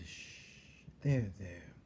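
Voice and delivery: hushed, gentle comfort; soothing. A narrator's reading. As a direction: soothe her softly. Louise comforting Cook.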